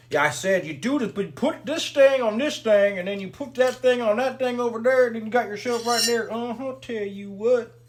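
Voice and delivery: loud, high, goofy put-on voice; put-on silly voice. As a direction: whoop it up in a high, loud character voice. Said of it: "I don't know why I'm talking like that".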